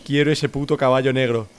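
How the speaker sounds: usual speaking voice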